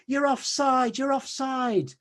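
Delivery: high mocking jeer; imitating a moaning fan in the stands. Fan jeering the striker, high and mocking. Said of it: "And they'd be going".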